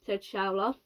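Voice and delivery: plain reading voice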